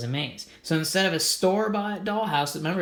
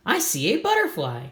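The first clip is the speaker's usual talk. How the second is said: giggly; 7 semitones higher, 3 dB louder than usual. Acted. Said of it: "Gertie giggled".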